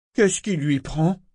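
slow, high, sniffy disdain; disdainful. Acted. The governor sneers about the stricken girl, disdainfully. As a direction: look down your nose at her. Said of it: "avec dédain".

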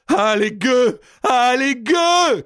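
very high, drawn-out scream; desperate cry. A narrator's reading. King seized and turned by his killers, desperate cry. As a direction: scream the curse, stretching the last word. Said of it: "cria-t-il".

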